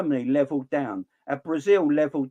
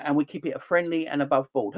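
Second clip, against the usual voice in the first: ordinary talk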